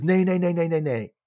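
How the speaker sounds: high rapid-fire protest; mock foreigner's flustered no; gibberish refusal. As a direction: babble a string of high refusals. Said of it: "And he says".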